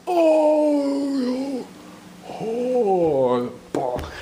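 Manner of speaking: hammed-up cry of the felled giant; Goliath's dying wail. Storyteller as Goliath struck on the head, drawn-out falling wail. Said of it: "Goliath goes".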